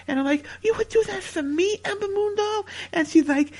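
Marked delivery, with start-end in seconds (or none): 0.6-2.7 high touched voice. Acted. Touched quote to his girlfriend.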